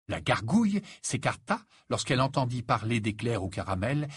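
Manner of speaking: plain reading voice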